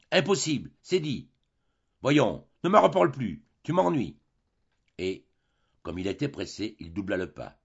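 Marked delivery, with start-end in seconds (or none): 0.1-4.2 higher, louder impatient brush-off; impatient refusal. A narrator's reading. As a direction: cut him off, you're fed up. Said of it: "avec un geste d'impatience".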